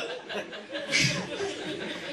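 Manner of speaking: a breathless high giggle; breathy high laugh. Real, not acted. Comic cracking up breathlessly at the story.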